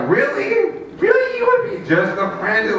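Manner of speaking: high, squeaky mock disbelief; incredulous. Guy told he is just a friend, incredulous. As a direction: pitch shoots up, hammed-up hurt surprise. Comic acts out being friend-zoned.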